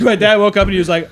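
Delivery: usual speaking voice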